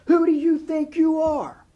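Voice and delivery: high, loud, slow furious roar. A narrator's reading. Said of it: "she roared".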